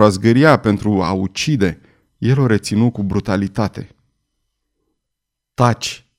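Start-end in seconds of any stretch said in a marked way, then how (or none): none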